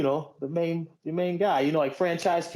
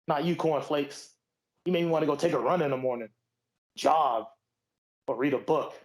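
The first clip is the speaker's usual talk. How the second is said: wrestling promo; 2 semitones higher than usual. Acted. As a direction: cut a cocky promo on cornflakes. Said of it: "I challenge the wrestler to do a promo".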